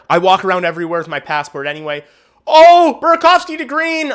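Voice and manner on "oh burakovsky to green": sudden high, loud hockey yelp; excited reaction. Real, not acted. Streamer reacting to a live Blackhawks chance, excited. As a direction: jump out of your seat at the play.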